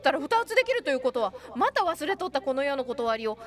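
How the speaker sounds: loud, grandly announced revelation; mock-solemn proclamation. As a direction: proclaim a silly law of the world. 大げさにこの世の理を宣言. (芝居がかった大げさな宣言)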